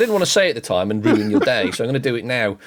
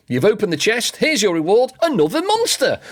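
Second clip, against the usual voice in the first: much higher, sing-song mock fanfare; sarcastic mock announcement; 12 semitones higher than usual. Real, not acted. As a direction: present the bad reward like a game show prize. Sarcastic jab at the game design.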